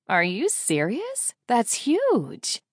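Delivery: high, drawn-out thrilled disbelief; big excited reaction to a friend's news. A narrator's reading. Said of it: "I can hear the excitement in her voice".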